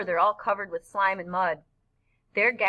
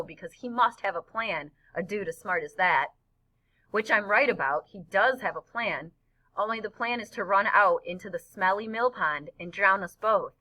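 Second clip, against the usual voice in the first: plain reading voice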